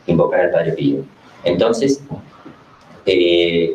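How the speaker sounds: ordinary talk, nothing marked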